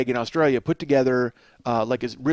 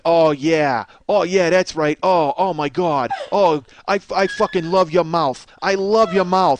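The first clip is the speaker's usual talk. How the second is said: loud New York moaning; New York accent; 4 dB louder, slower than usual. Retold or imitated. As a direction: groan it like a New Yorker in bed. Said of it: "He's like".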